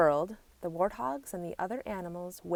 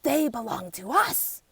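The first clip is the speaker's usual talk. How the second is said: growl; 4 semitones higher than usual. A narrator's reading. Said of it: "growl the other animals".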